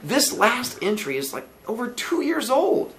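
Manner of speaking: high-pitched, drawn-out discovery; surprised Billy. Acted. Billy shows landlady the old date, surprised.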